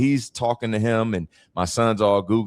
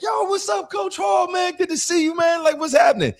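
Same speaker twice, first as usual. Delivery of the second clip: much higher, shouted hype greeting; excited greeting; 3 dB louder than usual. Retold or imitated. Star quarterback greeting his former coach, high excited shout. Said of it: "he turned around and was like".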